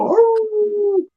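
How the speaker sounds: high howled woo like a dog. Retold or imitated. Imitating a howling dog for friends. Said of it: "'cause dogs go".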